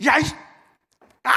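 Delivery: high, loud mock yell of a small boy; short high-pitched tantrum outburst, imitated; imitated angry child. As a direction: act out a kid exploding at his big sister. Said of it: "갑자기 막 커가지고 막 화가 나니까".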